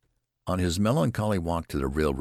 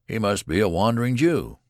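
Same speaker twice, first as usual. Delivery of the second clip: loud, higher mocking yell; jeering shout; 6 semitones higher, 5 dB louder than usual. A narrator's reading. Mob jeering at Benjamin, loud mocking shout. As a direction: taunt him across the crowd. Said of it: "ran shouting after the procession".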